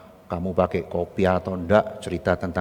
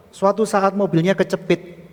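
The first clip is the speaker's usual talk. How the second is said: loud, high-pitched breathless-with-grievance retelling; agitated complaint; 11 semitones higher, 7 dB louder than usual. Acted. Guard recounting the blocked car incident, agitated complaint. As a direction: plead helplessness to the pastor.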